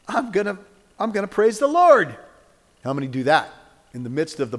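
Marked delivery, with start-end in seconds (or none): 0.1-2.2 higher and louder, rising to praise; joyful declaration. Retold or imitated. Preacher acting his own resolve to praise, loud and joyful. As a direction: burst out in triumphant praise. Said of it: "I honestly try in my mind to go".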